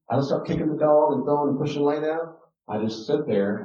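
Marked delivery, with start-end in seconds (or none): none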